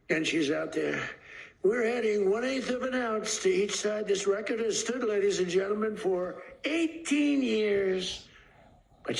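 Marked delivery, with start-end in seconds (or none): 1.6-8.3 higher, hammed-up announcer call, stretching out eighteen; mock announcer. Announcer tells the crowd about the record, mock stadium announcer voice. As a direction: play the arena announcer building up the record.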